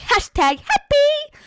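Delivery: very high, loud, drawn-out mockery; mocking squeal. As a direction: squeal it slowly to ridicule her post. Mocking her post in silly voice.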